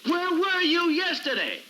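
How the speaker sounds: very high-pitched, exasperated yell; angry demand, stretched out and high; furious outburst. Acted. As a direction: explode at him, voice shooting way up. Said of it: "calm down".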